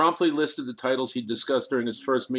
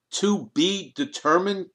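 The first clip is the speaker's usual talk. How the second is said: higher, slow incredulous echo of "To be determined?"; blurted out; 6 semitones higher, slower than usual. A narrator's reading. Kriegel blurting disbelief at Sanchez's vague answer, higher and slow. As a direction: throw "determined" back in disbelief. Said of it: "Kriegel blurted out".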